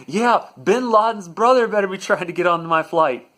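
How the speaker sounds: loud, high heckle from the line; indignant passenger shouting his support; angry pile-on. Retold or imitated. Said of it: "piles on and says".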